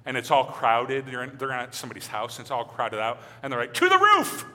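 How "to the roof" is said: mock rallying cry. Friends decide to climb roof.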